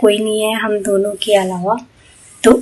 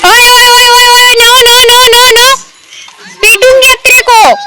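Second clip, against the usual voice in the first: scolding shout; 13 semitones higher, 6 dB louder than usual. Real, not acted. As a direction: shout them apart like an angry nanny. Scolding kids fighting in park.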